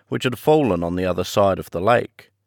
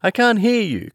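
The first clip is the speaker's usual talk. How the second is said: high, raised voice over gunfire; cry over the noise; 12 semitones higher than usual. A narrator's reading. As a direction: call out over the bombs. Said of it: "cried Kisman".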